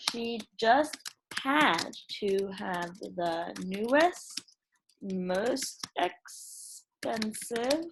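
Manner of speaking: teacher typing a story aloud for her class, slow soft dictation; dictating while typing. Acted. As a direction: read each word out slowly as you type it.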